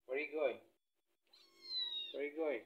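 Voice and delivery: normal talking voice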